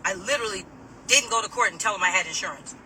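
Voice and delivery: normal talking voice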